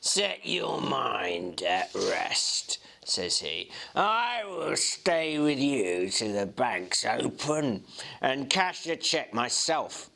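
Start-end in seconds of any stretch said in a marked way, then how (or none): 0.0-2.8 slow, low sneering whisper; a very slow, hushed, sneering brush-off; easy sneering. A narrator's reading. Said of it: "He was quite easy and sneering". 3.9-10.1 lightly voiced slow, unbothered sneer; calm and superior, in no hurry; a hint of cool contempt, slow; easy sneering. A narrator's reading. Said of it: "He was quite easy and sneering".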